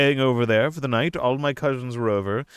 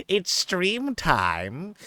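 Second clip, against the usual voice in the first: drawn-out announcement; 6 semitones higher, much slower than usual. Acted. Announcing stream start.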